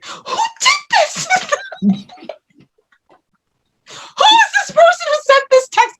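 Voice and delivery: very high tearful bewilderment; mock wailing. Woman baffled by her own forgotten text, mock wailing. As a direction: imitate crying out in disbelief. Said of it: "I literally sat there crying".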